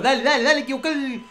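high, excited rallying cries; urgent cheering on. Real, not acted. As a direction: urge the team on without a breather. Urges the team on after scoring. (aliento agudo y exaltado)

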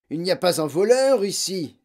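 loud, high relieved declaration; keen relief. A narrator's reading. Father finding the room empty, relieved. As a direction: announce it with open relief. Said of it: "avec un vif soulagement".

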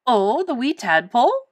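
loud, lilting Scottish voice; swooping Scottish-accented question; Scottish uncle. A narrator's reading. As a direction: answer in a cheery Scottish accent.

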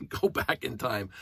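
ordinary delivery

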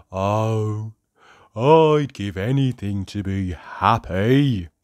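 slow, wistful sad moan; Mister Miserable. A narrator's reading. Miserable character longs for happiness, slow and mournful. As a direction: draw it out with miserable longing. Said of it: "said Mister Miserable".

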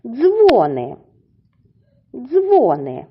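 "Дзвони" with high stressed Дзво-, falling on -ни; stress on first syllable. Shown as a demonstration. Teacher naming the next word for children, high stressed first syllable. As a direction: hit DZVO high in "Дзвони". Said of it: "наголошуємо на перший склад".

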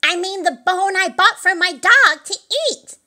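high, loud, exasperated explanation; annoyed. A narrator's reading. Old Mother Hubbard setting Simple Simon straight, loudly annoyed.

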